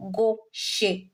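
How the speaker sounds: "gauche" read as go-shé, two slow syllables; teacher's hypothetical "gauche" with an accented ending; sounded final é. Shown as a demonstration. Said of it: "we had the accent like this".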